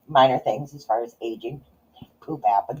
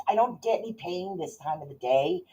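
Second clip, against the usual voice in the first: normal talking voice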